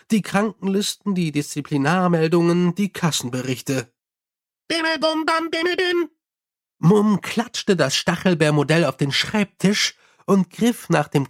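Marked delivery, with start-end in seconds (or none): 4.7-6.1 imitated chime. Imp's chime goes off.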